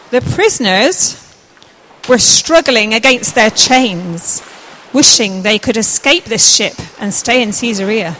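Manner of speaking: loud, slow, deliberate storytelling; projected children's story reading; story narration. A narrator's reading. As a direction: narrate it slowly for the actors to follow. Narrator resumes the scene.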